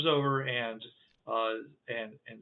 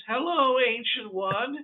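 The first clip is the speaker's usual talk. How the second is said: high, whistling creature greeting; high whistling alien greeting an elder; whistly Demixi voice; 10 semitones higher, slower than usual. Acted. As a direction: pipe it up like a kazoo. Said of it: "the Demixi voices are whis-, are like whistle sounds".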